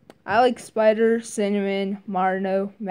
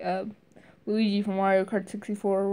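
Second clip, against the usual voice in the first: ordinary delivery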